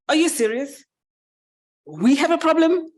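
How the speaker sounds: high, loud incredulous questions; scoffing disbelief. Acted. Pig scoffing at the mouse's mousetrap worry, incredulous. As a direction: climb in pitch as if it's absurd.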